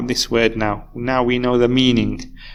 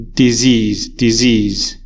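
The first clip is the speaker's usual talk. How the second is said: disease, louder and punched on SEASE; stress on second syllable; 3 dB louder, much slower than usual. Shown as a demonstration. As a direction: hit the SEASE in disease hard, twice. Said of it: "that's the stressed or the strong syllable".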